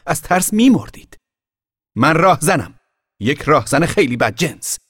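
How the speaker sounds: put-on high, loud boyish boast; shouting. A narrator's reading. Boy in the tree shouting he's a bandit. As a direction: yell up from the tree to scare her. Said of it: "فریاد زد".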